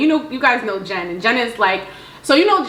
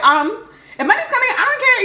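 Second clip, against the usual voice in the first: much higher, breezy imitation of her friend; Jen's high voice; 13 semitones higher than usual. Telling friends how Jen shrugged off the hassle, high squeaky mimic. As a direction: pitch it way up like Jen does. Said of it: "You know how her voice gets like that".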